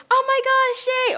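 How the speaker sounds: high, squealing delight; excited. Retold or imitated. Girl retelling her reactions to the film, thrilled. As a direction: squeal it with glee.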